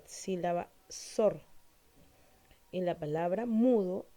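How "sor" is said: "sor" drawn out slowly as the strong syllable; stressed syllable SOR. Shown as a demonstration. Teacher showing students the stressed syllable of sordo, stressing "sor". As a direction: isolate "sor" and lean on it. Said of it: "una mayor fuerza de voz".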